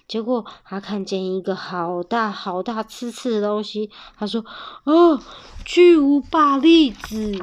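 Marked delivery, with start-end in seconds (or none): 4.9-7.4 high, loud, drawn-out exclamation; amazed discovery. Acted. Bear spotting a giant chestnut, amazed. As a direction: marvel slowly and loudly at a huge find.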